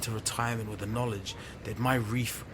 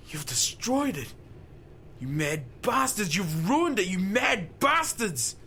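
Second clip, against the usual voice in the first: furious outburst; 6 semitones higher, slower than usual. Acted. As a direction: hurl the accusation at them. Harold screams at officials.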